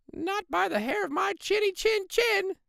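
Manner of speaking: very high squeaky little pig voice; squeaky pig refusal. Acted. Second pig refusing to let the wolf in, very high squeaky defiance. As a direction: squeal it defiantly from behind the door.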